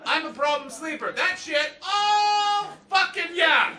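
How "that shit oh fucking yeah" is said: high, loud ecstatic oh yeah; blissed-out rush. Retold or imitated. Comic to audience, reliving propofol, loud ecstatic groan. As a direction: ride the wave of the drug hitting.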